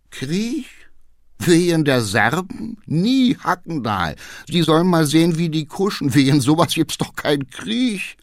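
high, incredulous dismissal; scornful disbelief. A narrator's reading. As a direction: wave away the idea indignantly. Old gentleman scoffs at war talk. (ungläubig und abfällig)

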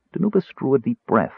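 usual speaking voice

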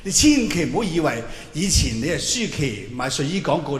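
ordinary talk

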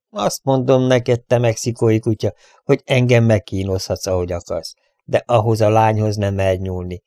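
ordinary delivery

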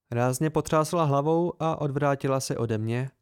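usual speaking voice

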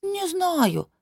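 put-on high puzzled child, drawn out; a small girl honestly unsure; puzzled drawl. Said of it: "озадаченно протянула девочка".

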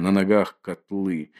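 usual speaking voice

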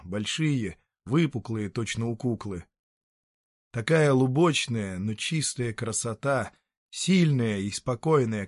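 ordinary delivery

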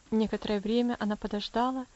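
ordinary delivery

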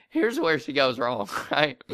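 ordinary talk, nothing marked

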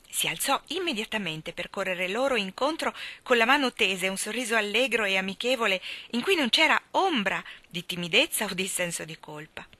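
ordinary delivery

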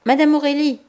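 loud, high summons; calling out. A narrator's reading. Bourdoncle summoning the head saleswoman loudly. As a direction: call her over sharply. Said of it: "appela Bourdoncle".

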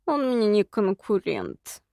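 put-on slow, drawn-out old man's scorn; dismissive old villain. A narrator's reading. Old stranger waving off a rival, dismissive. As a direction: stretch it out dismissively.

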